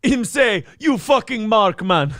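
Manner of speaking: high-pitched Jamaican patter; Jamaican accent. Acted. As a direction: put on a comic Jamaican accent. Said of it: "you should be from Jamaica".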